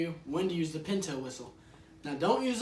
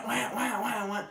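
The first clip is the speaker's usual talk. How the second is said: mock duck call; 5 semitones higher, slower than usual. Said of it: "I just hear".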